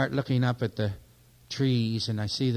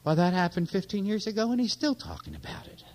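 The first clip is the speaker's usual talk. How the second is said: mock complaining listener; 6 semitones higher than usual. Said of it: "imitating listener's complaint".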